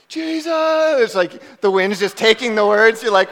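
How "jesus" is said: high, loud but feeble mock shout; acted-out puny cry swallowed by the wind; pitiful yell. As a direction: yell it hopelessly into a gusting wind. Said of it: "that was really pitiful".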